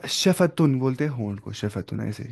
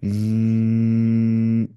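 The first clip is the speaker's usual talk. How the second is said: long steady humming न्न्न्न् through the nose; nasal hum; 3 dB louder, much slower than usual. Shown as a demonstration. Teacher demonstrating ghunna to students with a nasal hum. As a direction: hold a buzzing nasal hum. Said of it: "nasal cavity में आवाज़ को बंद कर देना ऐसे".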